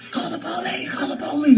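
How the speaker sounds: mock him begging for the cops; panicked brother. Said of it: "then he's like".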